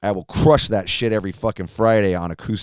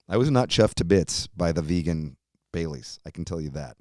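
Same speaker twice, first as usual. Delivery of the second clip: ordinary talk, nothing marked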